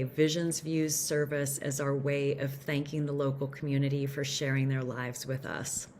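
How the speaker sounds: ordinary talk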